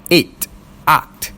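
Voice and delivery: acht said with a German guttural ch; eight, then the German acht; German word. Shown as a demonstration. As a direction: give acht its German ch sound. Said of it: "words evolving, such as seven".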